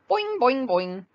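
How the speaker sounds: high, loud springy boings; bouncy boing. Acted. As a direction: bounce the sound out. Tigger bouncing sound.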